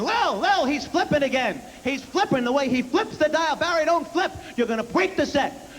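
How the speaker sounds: high, alarmed tattling dad; father complaining to wife Lil about the TV dial; dad calling mom. Retold or imitated. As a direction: call to the wife in a panic. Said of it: "He would bring my mom in".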